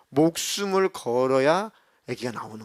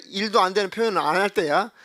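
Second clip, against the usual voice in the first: ordinary talk, nothing marked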